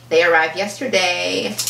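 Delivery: usual speaking voice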